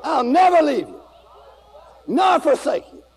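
preaching at full force. Acted. Preacher proclaiming Jesus' promise to the church, fervent. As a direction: preach it with fire.

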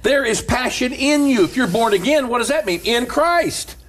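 building sermon cadence; preaching. Acted. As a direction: preach it up with fire.

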